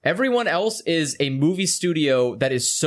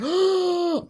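mock excited gasp. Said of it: "went like".

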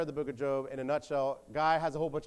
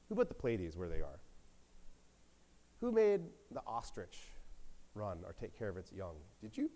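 God challenging Job; 3 semitones lower, 4 dB quieter than usual. Retold or imitated. God confronts Job about creation, pointed challenge. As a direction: let each question hang, then rise pointedly.

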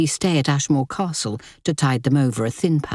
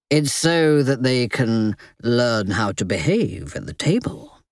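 low, calm male voice; level-headed man; 3 semitones lower than usual. Acted. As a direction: explain it steadily. Richard veiled rebuke.

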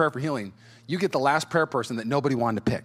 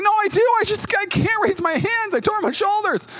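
defensive whine; 5 dB louder than usual. Retold or imitated. Defending himself at the retreat.

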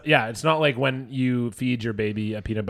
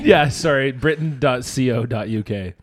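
usual speaking voice

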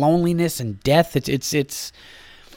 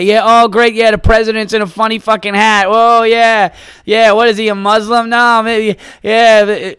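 higher, louder mocking enthusiasm; sarcastic; 4 semitones higher, 4 dB louder than usual. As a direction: fake excitement over a dumb joke. Mocking coworkers' email jokes.

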